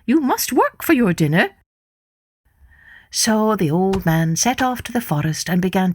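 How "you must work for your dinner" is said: put-on higher, sharp greedy old woman demanding her due; wife bossing her husband, a greedy old woman's voice. A narrator's reading. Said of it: "cried the greedy old wife".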